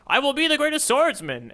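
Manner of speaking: high, proud declaration; mock shonen hero boast; mock heroic boast. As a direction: ham up a hero's vow. Mock Zolo boast quote.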